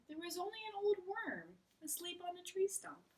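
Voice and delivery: Frog's voice. Acted. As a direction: Frog telling Toad about hunting spring, lively and high. Frog continues his story.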